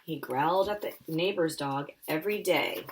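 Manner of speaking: ordinary delivery, nothing marked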